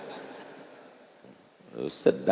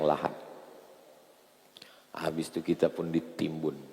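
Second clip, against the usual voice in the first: guided meditation; 8 semitones lower, 6 dB quieter than usual. Acted. Preacher guiding listeners to picture their funeral, softly. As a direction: lead them gently to imagine their own burial.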